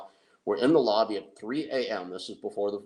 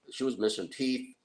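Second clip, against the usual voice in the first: normal talking voice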